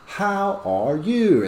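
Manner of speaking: high slow chorus question; slow class chorus. Shown as a demonstration. Class chorusing the question, slow. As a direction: ask it like the whole class at once. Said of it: "have the whole class say".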